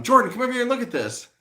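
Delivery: high, fast mock call for Jordan; squeaky-quick imitation of an eager buddy; teasing imitation of a friend calling him over; mimicking friend calling. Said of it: "Every time I'm looking at something".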